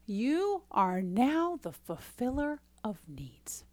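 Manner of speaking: slow hushed whisper; dramatic whisper. Acted. Coach whispers the payoff line to listeners, slow and hushed. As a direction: hush it slowly like a secret.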